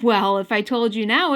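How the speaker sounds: ordinary delivery, nothing marked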